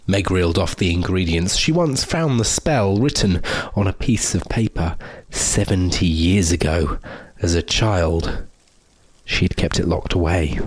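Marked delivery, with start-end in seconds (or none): none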